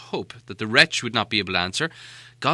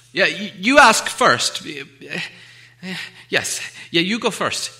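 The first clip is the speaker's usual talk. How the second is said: nervous stalling; 8 semitones higher, 7 dB louder, slower than usual. Acted. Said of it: "he said".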